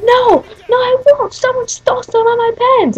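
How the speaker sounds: imitating a tantrum in a very high voice; high-pitched mock protest of an upset boy; pissed-off kid. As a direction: whine it way up high, mocking him. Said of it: "he started getting all pissed".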